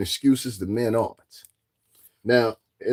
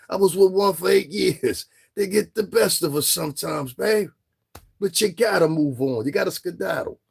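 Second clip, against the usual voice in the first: higher, teasing big-brother comfort; mock advice; 8 semitones higher than usual. Real, not acted.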